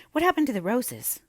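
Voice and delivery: lightly voiced curious young girl. A narrator's reading.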